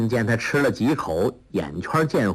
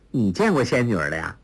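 ordinary delivery, nothing marked

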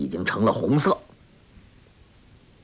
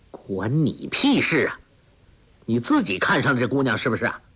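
ordinary delivery, nothing marked

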